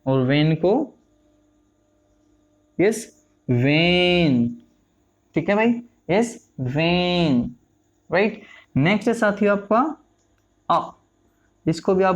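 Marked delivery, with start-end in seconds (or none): 3.5-4.5 वेन very slow, vowel held long; वेन drawn out as a pronunciation model; demonstrated long vowel. Shown as a demonstration. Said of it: "pronounce करोगे इस तरीके से". 6.6-7.4 वेन with its vowel held; वेन said long as a model word; demonstrated long vowel. Shown as a demonstration. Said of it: "pronounce करोगे इस तरीके से".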